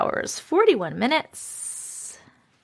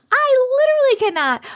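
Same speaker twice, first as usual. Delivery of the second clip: literally pitched way up and louder; exasperated squeal; 13 semitones higher, 4 dB louder than usual. Real, not acted. Streamer repeating her reaction, higher in exasperation. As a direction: squeal it in disbelief.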